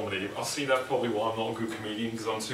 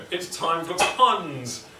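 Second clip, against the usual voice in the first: mock announcer; 7 semitones higher, much slower than usual. Introducing the pun segment, hammy announcer. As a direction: announce it like a cheesy TV host.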